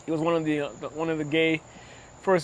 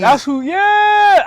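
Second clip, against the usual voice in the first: excited; 6 dB louder, much slower than usual. Real, not acted. Sudden excited recognition.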